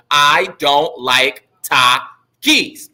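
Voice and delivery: slow, evenly spaced syllables; poem recitation. Shown as a demonstration. Teacher going over his haiku again, slow syllable-by-syllable recitation. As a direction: count out the syllables as you recite.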